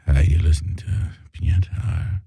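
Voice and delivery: low, breathy late-night DJ; sexy radio voice. Shown as a demonstration. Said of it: "Use your sexy radio voice".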